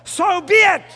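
ringing, emphatic affirmation; shouted declaration. Real, not acted. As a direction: cry it out as the climax. Preacher's emphatic climax of scripture.

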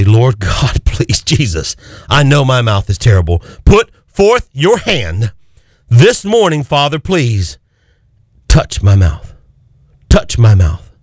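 earnest pleading prayer, pressing each request. Shown as a demonstration. Preacher models a prayer for listeners, pleading. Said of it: "need to say".

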